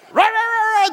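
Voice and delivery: loud, high dachshund yapping; imitated frantic barking. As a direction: bark wildly like a small dog on the chase. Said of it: "They're going and barking".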